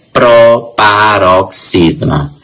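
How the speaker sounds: "Proparoxítona" slowed, with "pro" set apart; syllable by syllable. Shown as a demonstration. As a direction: say "Proparoxítona" slowly in separated chunks. Teacher repeats new term slowly. ("Proparoxítona" pausada, em pedaços)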